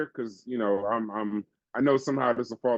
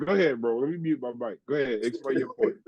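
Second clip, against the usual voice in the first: ordinary talk, nothing marked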